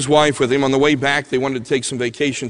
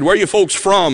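put-on heavy Southern accent; 6 semitones higher, 6 dB louder than usual. Old man greeting diners at their table, heavy Southern accent. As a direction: hail them like a folksy Southern stranger. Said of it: "asked in a heavy Southern accent".

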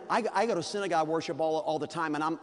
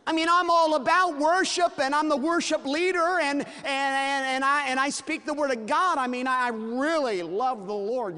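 high, loud bragging voice; a preacher hamming up a self-important David; boastful David; 9 semitones higher, 6 dB louder than usual. Acted. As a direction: show off your piety, pitched up. David bragging about his worship.

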